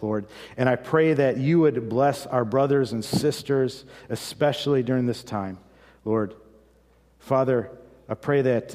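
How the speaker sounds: fervent spoken prayer to God. Acted. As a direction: pray aloud for the church, rising in intensity. Pastor leads closing prayer.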